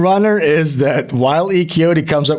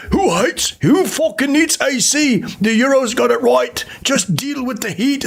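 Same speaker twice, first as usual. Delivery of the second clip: much higher, blustering tough-guy take; mock macho pundit, much higher; Andrew Tate; 8 semitones higher than usual. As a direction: bark it out like a macho influencer. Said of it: "His original take is".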